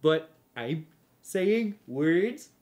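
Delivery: slow, stretched, rising reversed-sounding phrasing; backwards speech. Shown as a demonstration. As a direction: drawl it like a tape played backwards. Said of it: "need to sound real stupid".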